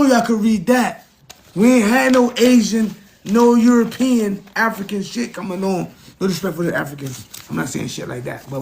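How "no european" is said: normal talking voice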